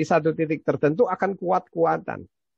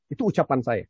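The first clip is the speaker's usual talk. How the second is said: ordinary delivery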